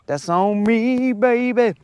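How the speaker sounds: put-on voice.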